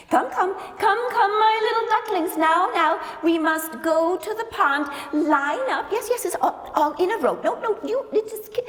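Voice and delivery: high, bright singsong calling; mother duck calling. Acted. Mother duck calling her ducklings to the pond, singsong. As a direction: gather the little ones cheerfully.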